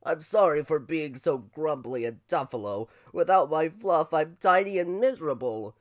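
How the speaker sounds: mildly miserable buffalo voice; sniffly buffalo. Acted. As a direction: apologise with a touch of self-pity. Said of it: "sniffed the Buffalo".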